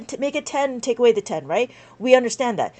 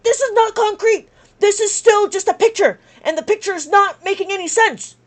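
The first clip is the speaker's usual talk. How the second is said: higher, louder, firm objection; indignant retort; 6 semitones higher, 4 dB louder than usual. Retold or imitated. Teacher retelling her comeback at the math summit, indignant. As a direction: shoot down the presenter's claim. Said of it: "It's like".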